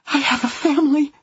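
high, breathless, crying plea; panting plea. A narrator's reading. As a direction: beg through panting sobs. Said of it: "she panted at last".

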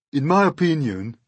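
high, loud, pompous earthworm; opinionated earthworm. Acted. Earthworm lecturing the others, opinionated voice.

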